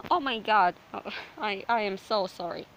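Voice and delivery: ordinary delivery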